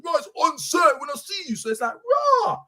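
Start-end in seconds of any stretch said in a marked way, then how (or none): 0.0-1.5 mock threat shout. Retelling the youth's threat to his friends, mock shouted. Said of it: "he said". 1.9-2.6 one stunned word of disbelief; shocked disbelief. Retold or imitated. Hearing the youth's threat, he reacts in shocked disbelief.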